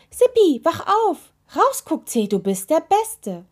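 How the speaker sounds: high, loud jubilant calling; excited shout. A narrator's reading. Witch waking her friend and praising the toe, excited shout. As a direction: rouse a sleeper, then cheer the toe. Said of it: "rief sie plötzlich hellwach".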